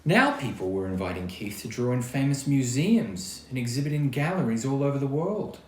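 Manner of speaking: ordinary delivery, nothing marked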